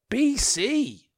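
high, loud, stunned B C?; like the maths just broke; shocked disbelief. Real, not acted. Host reading dates to listeners, suddenly shocked. Realises the date is BC.

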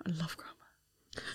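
whispered "I love grammar", slow. Real, not acted. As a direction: breathe it like a secret. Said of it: "I do".

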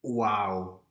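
flat stunned wow; stunned sarcastic wow; deadpan wow. Retold or imitated. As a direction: react in dry disbelief. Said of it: "And I was just like".

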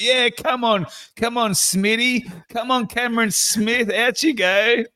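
high, goading sideline call; Reece Walsh. Retold or imitated. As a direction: jeer a mate onto the field. Imitating Walsh heckling the player.

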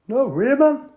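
high, loud Geordie no; Geordie denial. Acted. Philip denying to Tony, Geordie. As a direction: deny it in broad Geordie. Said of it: "an authentic, uh, Northerner".

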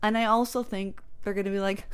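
ordinary talk

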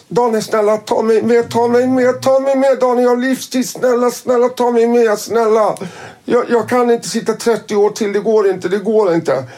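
high, pleading, over and over; Tony begging Daniel through the cell door, high and pleading; desperate begging. Retold or imitated. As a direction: beg him not to leave you behind. Lifer Tony begs to come along.

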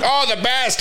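high, acted-out delight; thrilled kid. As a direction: gush like an excited child. Acting thrilled childhood self.

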